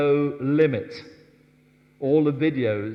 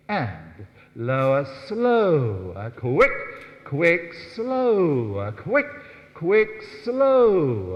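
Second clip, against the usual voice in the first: loud count of slow and quick; called rhythm; 4 dB louder, much slower than usual. Shown as a demonstration. Teacher counting the dancers through the turn. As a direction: call the rhythm steps. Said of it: "counting the reverse turn aloud".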